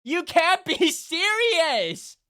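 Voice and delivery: high, loud, drawn-out laughing shout; disbelieving yell. Real, not acted. Streamer reacting to chat's result, loud stunned laugh-shout. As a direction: stretch it out in disbelief.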